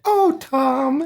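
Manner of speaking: high, swooning lovestruck sigh; swooning girl. Retold or imitated. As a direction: coo it like a lovesick cartoon.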